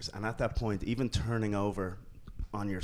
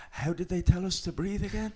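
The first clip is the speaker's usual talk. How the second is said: higher, louder earnest retold question; sincere question; 10 semitones higher, 5 dB louder than usual. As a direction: replay an urgent, genuine question to a companion. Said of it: "truly sincere question".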